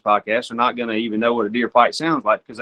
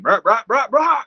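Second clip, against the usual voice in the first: higher, louder grunts climbing on each bruh; louder deer grunts; 9 semitones higher than usual. Shown as a demonstration. Hunter raising the call until the deer stops: louder, rising buck grunts. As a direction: grunt harder so the buck finally hears. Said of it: "you gotta get louder and louder".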